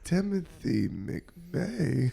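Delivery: drawn out very slowly; comic slow reveal of a name; slow mock read-out. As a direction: sound out a search result, word by dragged word. Imagined reading of a Google result.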